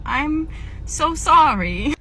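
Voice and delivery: high, slow wincing apology; drawn-out apology. Acted. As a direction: stretch out the apology, pitch rising. Breaking bad news to customer.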